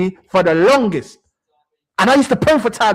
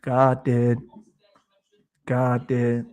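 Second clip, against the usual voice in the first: much lower, a slow solemn boss; deep godfather voice; 11 semitones lower, 5 dB quieter, much slower than usual. Pretend. Fan boasting to Fauzi that God backed them, deep and solemn. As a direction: intone it like a mob don.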